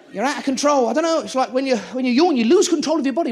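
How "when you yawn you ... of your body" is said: ordinary talk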